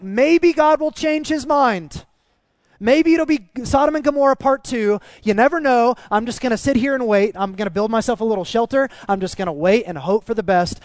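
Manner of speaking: loud, high-pitched hopeful scheming; hope out loud, hammed up and high; eager hoping. Voices Jonah hoping for destruction.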